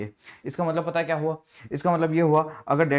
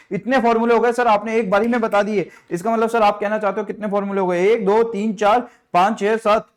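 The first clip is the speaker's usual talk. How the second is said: high, loud, excited student showing off; eager student voice; 7 semitones higher, 6 dB louder than usual. Acted. Teacher plays a thrilled student, high and eager. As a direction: play the keen pupil who already knows it.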